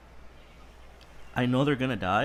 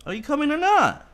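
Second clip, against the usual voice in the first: high, loud impatient call; 7 dB louder than usual. Real, not acted. Calling his lagging animal.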